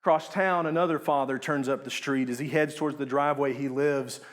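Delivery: usual speaking voice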